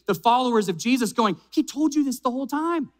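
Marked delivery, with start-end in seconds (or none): 1.5-2.9 mildly fed up, voiced high; mock frustration. Modern believer chiding the followers of Jesus, mildly frustrated. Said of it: "sometimes we get frustrated".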